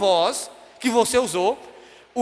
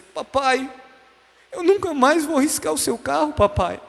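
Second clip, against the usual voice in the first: put-on higher, childlike pleading voice; tearful little girl; 5 semitones higher than usual. Little girl who lost her hand speaking to papai, pleading child voice. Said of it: "mudei o tom de voz na hora".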